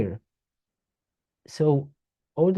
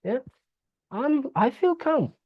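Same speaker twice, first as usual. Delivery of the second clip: much higher, airy voice of the agreeing body; body's voice; 12 semitones higher than usual. Acted. The body answering her, pleased and pitched way up. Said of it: "and the body says".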